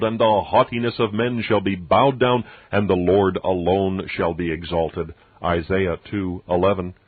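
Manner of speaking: usual speaking voice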